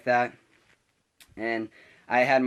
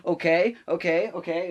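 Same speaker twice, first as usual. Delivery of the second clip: high, louder, rising each time; challenging mock okays; 7 semitones higher, 4 dB louder than usual. Substitute looking round the class, challenging. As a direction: demand agreement like a bossy teacher.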